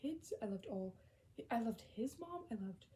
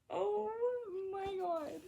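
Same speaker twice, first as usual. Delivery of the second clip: high, loud squeal of shock; overwhelmed gasp; 10 semitones higher, 5 dB louder, slower than usual. Real, not acted. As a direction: let it burst out, overcome. Overwhelmed by the quote.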